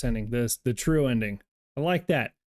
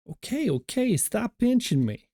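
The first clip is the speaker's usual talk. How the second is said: squeaky pleading; 12 semitones higher than usual. Acted. Character being pinched begs the pincher to stop, squeaky and high.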